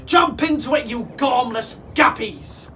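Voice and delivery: loud, scolding pirate order; urgent, insulting captain; captain's shout. A narrator's reading. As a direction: hustle the crew with a yell. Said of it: "shouted Captain Snatchit".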